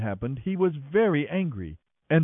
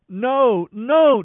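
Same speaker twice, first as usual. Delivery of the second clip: rising, much louder and higher desperate no; crying out; 9 semitones higher, 8 dB louder than usual. A narrator's reading. Tamar rejected by Amnon, loud rising desperate cry. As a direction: wail it, each no higher than the last. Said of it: "Tamar cried".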